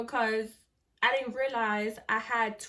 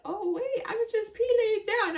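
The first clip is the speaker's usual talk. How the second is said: much higher, laughing surprise; amused realization; 11 semitones higher than usual. Retold or imitated. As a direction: discover it with a giggle. Funny realisation in hospital bed.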